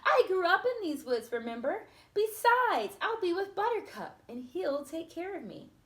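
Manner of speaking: bright princess reassurance; cheerful confidence. Acted. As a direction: sound sure of yourself, upbeat. Aurora reassures Philip cheerfully.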